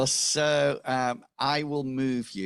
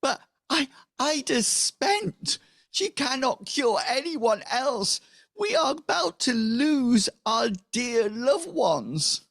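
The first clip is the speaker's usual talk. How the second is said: very high, loud wailing plea; hammed-up tearful desperation, words stretched; desperate villager; 5 dB louder than usual. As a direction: wail it out in despairing falsetto. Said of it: "She says".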